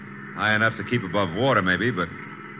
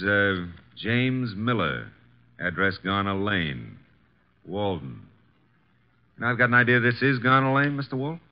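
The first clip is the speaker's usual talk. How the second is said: normal talking voice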